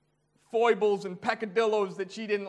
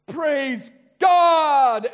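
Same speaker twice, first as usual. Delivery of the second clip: joyful shout; 7 semitones higher, 10 dB louder than usual. Retold or imitated. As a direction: Praise God shouted much higher and louder. Joyful praise at the good news.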